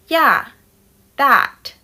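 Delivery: two short firm words with a pause between; placating toddler. Retold or imitated. Son pretends to agree.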